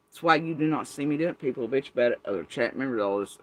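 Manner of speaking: normal talking voice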